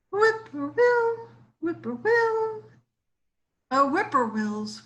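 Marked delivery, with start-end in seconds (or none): 0.1-2.6 high whistling bird call; whippoorwill whistle. A narrator's reading. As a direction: whistle the name from the swamp. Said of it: "whistles from the edge of a swamp".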